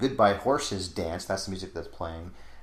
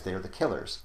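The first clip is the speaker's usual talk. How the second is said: ordinary delivery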